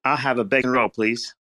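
American-sounding fake British. Copying the Brit's bacon roll order, very American fake British. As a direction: try British but stay thoroughly American. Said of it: "That, that sounded so, like, American".

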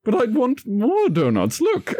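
high, petulant demand; childish want. Acted. James keeps asking for donuts.

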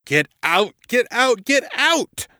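frantic cry climbing to a very high scream; angry, terrified cry. A narrator's reading. Tormented man pounding his head on railing, angry, terrified cry. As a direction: start high, escalate into hysterical shrieking. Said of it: "man was both angry and terrified".

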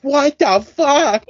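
imitated talk-show host's stunned swear; Elizabeth Hasselbeck outburst. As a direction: throw it out like storming off a TV set. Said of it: "Elizabeth Hasselbeck storms off the set".

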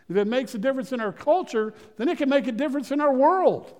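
a preacher driving home the closing point, climbing; preaching. Acted. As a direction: preach it home, rising each line. Preaching climax to congregation.

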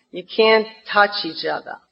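usual speaking voice

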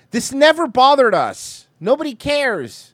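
high, slow, loud outburst; loud rant. Real, not acted. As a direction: yell it slowly, pitch way up.

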